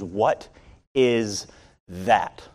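a hint of a cold, unamused guard; lightly voiced security guard interrogating; stern guard. Unhappy guard, dead-eyed question.

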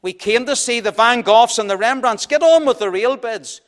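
angry bidder shouting at the auctioneer. A narrator's reading. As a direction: bark it at the auctioneer in outrage. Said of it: "Another voice shouted out angrily".

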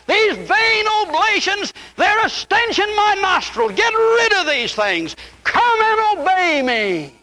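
slow, high, solemn divine rebuke; voicing God. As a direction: proclaim it slowly as God's own words. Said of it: "He said".

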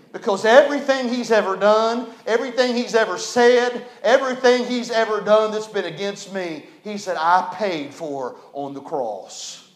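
rising, slowing sermon delivery; preaching. Acted. Preaching the pardon.

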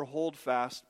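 ordinary delivery

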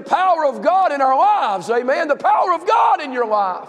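full-throated revival preaching; preaching at full force. Acted. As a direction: preach it up to the congregation. Pastor preaching, building to shout.

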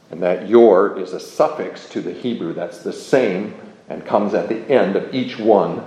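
ordinary talk, nothing marked

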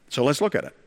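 normal talking voice

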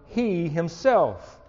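"He himself" drawn out slowly, weight on he; slow, heavy stress on "He"; emphatic, slow. Shown as a demonstration. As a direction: lean on "He himself". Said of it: "The language here is emphatic".

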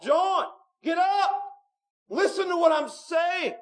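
high, slow commanding call; wake-up call shout. Acted. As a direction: call him awake like a trumpet. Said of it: "It's like a wake-up call".

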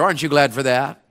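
ordinary delivery

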